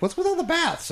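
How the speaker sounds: mock high-pitched wife asking; wife's puzzled question. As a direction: squeak it out like a baffled wife. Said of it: "and she's like".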